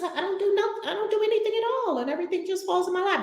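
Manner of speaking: mock carefree misbelief; mocking misconception. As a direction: ridicule the lazy idea. Mocking a misconception she rejects.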